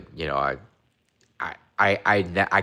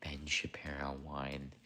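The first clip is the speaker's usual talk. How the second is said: unvoiced whispered reread; whispered reread of a chat comment; 4 semitones lower, 16 dB quieter than usual. Real, not acted. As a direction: breathe the words out without voice.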